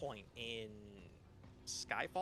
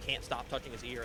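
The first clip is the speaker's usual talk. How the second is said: usual speaking voice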